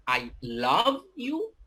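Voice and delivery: "love" drawn out high, "you" lifting like a question; slow "I love you", doubting the word "love"; questioning stress on love. Shown as a demonstration. Teacher putting a question on love, "love" stretched and questioned. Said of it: "putting a question on love".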